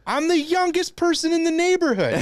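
very high-pitched, strained-up comic rant; exasperated outburst. Real, not acted. Comic berating himself over the neighbors, high exasperated outburst. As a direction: let the voice leap way up in protest.

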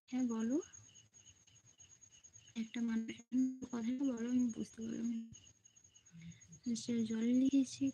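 usual speaking voice

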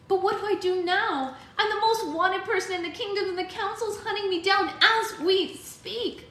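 put-on frightened girl asking for help; young girl voice. A narrator's reading. Alex worried.

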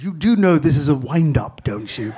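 a low, teasing aside; sly reveal. Retold or imitated. Oliver Reed telling the comic it's a wind-up, lightly teasing. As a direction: let him in on the joke, dry.

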